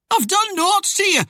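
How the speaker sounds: very high, frightened protest; squeaky croak. A narrator's reading. Accused man pleads innocence to Death, squeaky croak. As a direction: squeak out the denial. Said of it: "in a squeaky croak".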